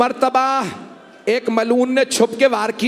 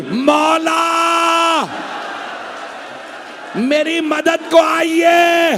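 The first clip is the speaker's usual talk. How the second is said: crying out for help; 4 semitones higher, much slower than usual. Retold or imitated. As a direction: stretch out the first word as a desperate cry for help. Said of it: "आवाज दी".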